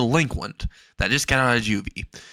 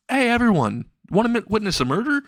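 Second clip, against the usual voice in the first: high, showy mock announcement; calling to everyone; 11 semitones higher than usual. A narrator's reading. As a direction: call the crowd over for a spectacle. Announcing to the whole crowd.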